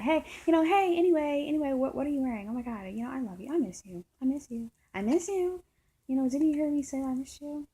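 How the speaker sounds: high, loud, gushing imitation of a partner; mock her scattered, pushy affection with sudden swells; erratic. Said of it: "getting erratic again".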